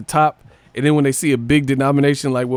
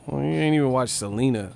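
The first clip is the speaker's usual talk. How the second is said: ordinary talk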